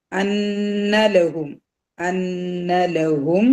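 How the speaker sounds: അന്നലഹും very slow with long hummed nn; two-count nasal. Shown as a demonstration. Teacher shows ghunna for two counts, അന്നലഹും hummed long on 'nn'. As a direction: hold the n of അന്നലഹും in a nasal hum. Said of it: "രണ്ട് count മണിക്കുക".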